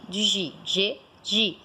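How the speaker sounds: G said as the soft zh sound, repeated; teacher sounds out the soft G; sound demo. Shown as a demonstration. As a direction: hum the soft G sound a few times. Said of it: "esse som aqui".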